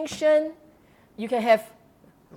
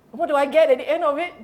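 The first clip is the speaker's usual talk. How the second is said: fast mock complaint; 5 semitones higher, 5 dB louder, much faster than usual. Devotee's complaint acted out.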